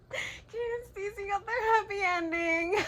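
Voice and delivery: high, loud laughing sob through tears; real tears of happiness breaking her voice; crying with joy. Real, not acted. Telling viewers the couple got their happy ending, crying with joy. Overjoyed about characters' ending.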